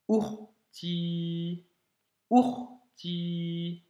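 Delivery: "urti" with a catch before the ou; "urti" said slowly, the ou started by a glottal stop; slow Arabic "urti" with a throat stop on the ou; Arabic word urti. Shown as a demonstration. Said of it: "Tu vois, blocage, blocage du ou".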